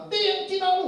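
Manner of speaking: high jeering call across the road; mock street taunt. Jeering kid calls out to the crossing man, high and mocking.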